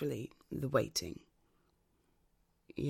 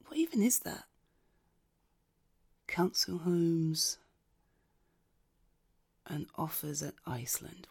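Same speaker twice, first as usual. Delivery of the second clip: whispering; 6 dB quieter than usual. Real, not acted. Reading the waiting-room screen.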